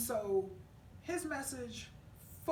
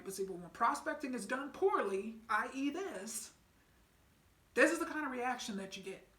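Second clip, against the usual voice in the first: normal talking voice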